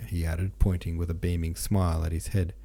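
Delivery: ordinary delivery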